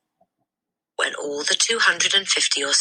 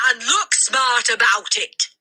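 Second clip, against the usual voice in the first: higher, slow snapping order; shouted; 9 semitones higher than usual. A narrator's reading. The headmistress shouts at the boy. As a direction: bark it, each word hard. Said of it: "the Trunchbull shouted".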